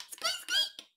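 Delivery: high squeaky mouse voice; mouse squeak. Acted. Imitating a mouse.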